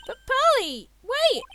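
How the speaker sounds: high, loud call after her. Acted. Nick calling after fleeing Pearl, loud and high. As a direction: shout for her to stop.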